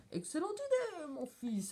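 high-pitched, delighted judge; applauding. A narrator's reading. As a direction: approve with a swoop down from high. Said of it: "applaudit le juge". (approbation enthousiaste, voix haute)

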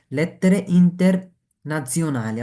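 "lettere internazionali" drawn out syllable by syllable; slow teacher model phrase. Shown as a demonstration. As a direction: spell out "lettere internazionali" slowly. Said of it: "slow model phrase".

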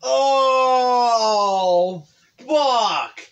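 long, high, loud cry of discovery; shouted realization. Real, not acted. Friend suddenly getting the band reference, shouted realization. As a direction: yell out as it dawns on you.

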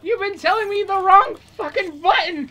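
high, loud exasperated shout at a friend; angry yell. Real, not acted. Gamer yelling at her co-op partner over the wrong button, furious shout. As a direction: blow up in frustration.

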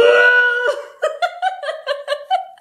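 a high, loud burst of laughter; real laughing fit. Real, not acted. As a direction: crack up helplessly.

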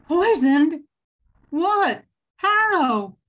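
high, loud stunned disbelief; shocked gasp. Acted. Widow told husband was poisoned, shocked gasp. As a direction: gasp as if the news hits hard.

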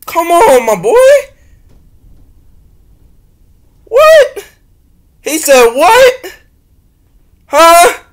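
high, loud incredulous cries; loud disbelief. Real, not acted. Reacting to the lyric, loud disbelieving cries. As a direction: shout in disbelief, half laughing.

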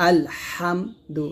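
আলহামদু slowly, ha pressed out from mid-throat; slow syllable-by-syllable আলহামদু with a throaty হা; deep throaty ha. Shown as a demonstration. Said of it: "এরকম".

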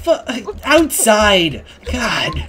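loud, high, exasperated yell; exasperated outburst. Real, not acted. Builder yells at a friend who ignored the outside, exasperated. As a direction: shout in frustration that they missed it. Friend missed the new entrance.

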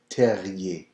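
terrier with a firmly rolled R; rolled French R. Shown as a demonstration. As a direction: roll the R in terrier, ending in ye. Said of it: "there's the roll of the R".

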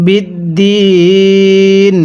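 বিদ্দিন drawn out very slowly; slow recitation. Shown as a demonstration. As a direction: stretch বিদ্দিন in chant. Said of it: "হ্যাঁ, পড়ুন". (বিদ্দিন খুব টেনে তিলাওয়াত)

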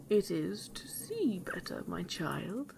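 soft wolf, falling away at the end; soft coaxing wolf. A narrator's reading. Wolf in bed reassures the girl, soft and coaxing. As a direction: coax quietly, as if posing as granny.

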